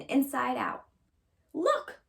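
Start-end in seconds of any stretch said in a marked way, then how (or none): 1.5-2.0 growl. Acted. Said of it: "growled Papa Honey Bear in his great big voice".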